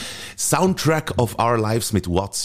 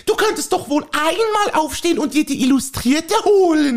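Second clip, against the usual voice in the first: a higher, fussy housewife voice pestering her husband; nagging wife. Acted. Wife nagging her husband to go for a walk, fussy. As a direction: play the nagging wife in a comic sketch.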